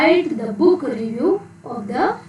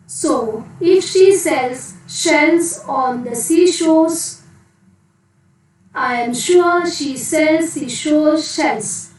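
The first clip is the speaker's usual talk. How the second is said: slow, raised, each word spelled out; slow careful tongue twister; 3 semitones higher, slower than usual. Shown as a demonstration. Teacher models a tongue twister for children, slow and careful. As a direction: lay out every sh sound slowly for the class. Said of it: "Next, one more".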